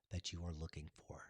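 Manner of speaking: soft unvoiced whisper. Real, not acted. Whispering the close of her message to viewers. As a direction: let it fall away into a whisper.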